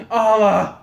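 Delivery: gasped, loud mock moan; hammed-up imitation of a woman screaming. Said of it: "she's, like, screaming".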